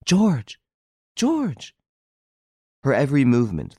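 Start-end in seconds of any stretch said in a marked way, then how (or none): 0.0-1.8 high, falling lover's calls; yearning love. A narrator's reading. Girl at the window calling her hidden lover, yearning. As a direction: call the beloved's name high and longingly. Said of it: "the thrill of her voice, her whole being expressed desire and love".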